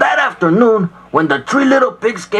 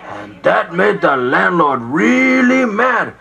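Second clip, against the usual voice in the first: ordinary talk, nothing marked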